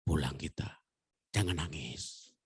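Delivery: low, soft, slowing murmur; quiet, drawn-out reassurance; hushed consoling. Retold or imitated. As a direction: hush it low, comforting a crying wife. Husband consoles wife after diagnosis.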